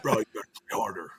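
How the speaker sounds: quick mock whisper of the teammate; hushed fast teammate comeback; whispered fast teammate retort. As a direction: rush it out under the breath, teasing.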